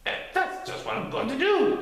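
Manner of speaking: high giggly critter; laughing Titter. A narrator's reading. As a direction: bounce it out gleefully. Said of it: "laughed Titter".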